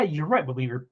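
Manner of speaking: ordinary talk, nothing marked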